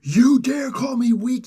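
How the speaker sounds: high, loud, drawn-out mock fury; hammed-up villain outrage; infuriated. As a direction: roar it slowly, high-pitched and offended. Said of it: "So Apocalypse gets infuriated".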